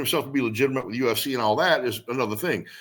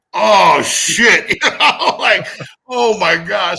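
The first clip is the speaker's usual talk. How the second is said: shocked shout; 8 semitones higher, 10 dB louder, much slower than usual. Retold or imitated. Retelling shock at bad news.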